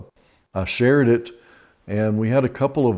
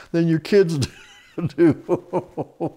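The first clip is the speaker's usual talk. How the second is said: slow, high words broken up by real laughter; can barely get the punchline out for laughing; laughing through words; 7 semitones higher, much slower than usual. Real, not acted.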